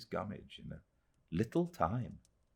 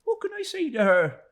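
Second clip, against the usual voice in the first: high, louder sorrowful mother's voice; Mrs Joram voiced; 7 dB louder than usual. A narrator's reading. Mrs Joram telling David of Minnie, high and sorrowful. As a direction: mourn for the child, high.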